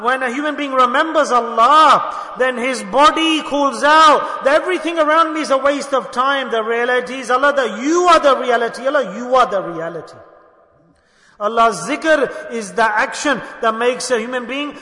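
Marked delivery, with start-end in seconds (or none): 0.0-10.2 loud, fervent proclamation; loud preaching. Acted. Preacher urging the crowd to remember Allah, loud. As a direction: drive it home with force.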